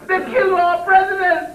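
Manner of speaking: high-pitched mock holler, laughing through it; retold holler. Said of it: "And she hollered".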